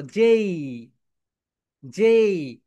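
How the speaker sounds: teacher leading a repeat-after-me on যেই; call and repeat. Shown as a demonstration. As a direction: lead the class in saying যেই. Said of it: "সবাই আমার সঙ্গে বলো".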